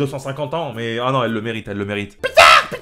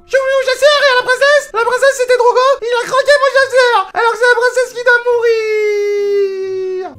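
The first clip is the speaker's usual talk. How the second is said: put-on bratty little girl; 8 dB louder than usual. [name] explaining her princess game, very high bratty whine. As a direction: play the spoiled kid whining about her game.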